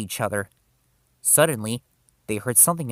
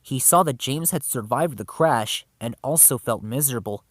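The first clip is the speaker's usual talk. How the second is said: plain reading voice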